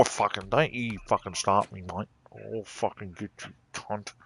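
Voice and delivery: jokey muttered threat at the screen. Acted. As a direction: menace it under your breath. Mock threat at the game.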